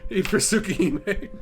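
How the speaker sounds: laughing. Real, not acted. Laughing while reading title.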